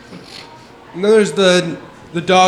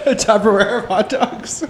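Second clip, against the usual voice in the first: laughing; 7 semitones higher than usual. Real, not acted. Laughing at the hot dog stash.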